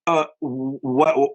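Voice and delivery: high, halting mock confusion; retold bafflement. Band asking kid about his wheels, baffled. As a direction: stammer it as if baffled.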